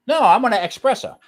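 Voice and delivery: pushy customer demanding an espresso; mock customer insisting. A customer insisting on an espresso, loud and firm. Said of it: "So I would say".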